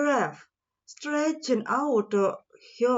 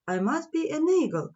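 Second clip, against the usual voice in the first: higher and fast, eager little mouse; 7 semitones higher, faster than usual. Acted. Little mouse deciding he is an eagle, higher and fast. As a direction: mouse sure he has finally found his place.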